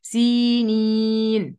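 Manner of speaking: سينين slow, the ī after the seen drawn long. Shown as a demonstration. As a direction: stretch the ī in سينين. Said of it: "في ياء بعد السين".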